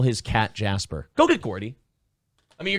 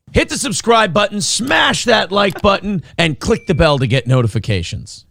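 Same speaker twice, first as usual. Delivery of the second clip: loud, higher announcer shout; hammed-up subscribe plea; 5 semitones higher, 5 dB louder than usual. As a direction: smash it like a cheesy YouTube ad. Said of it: "Oh, play the thing".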